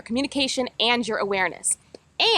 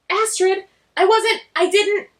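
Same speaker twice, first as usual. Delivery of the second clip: flustered; 8 semitones higher, 5 dB louder, slower than usual. Acted. Astrid caught, flustered.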